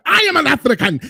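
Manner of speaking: high-pitched imitation of an African accent; mock African accent. Host mimicking the woman from the clip, mock African accent. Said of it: "She had an accent".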